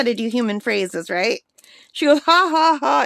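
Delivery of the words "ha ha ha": high loud mock parrot ha ha ha; imitated parrot cracking up; parrot laugh. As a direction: cackle it like a laughing bird. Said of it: "she cracked up laughing".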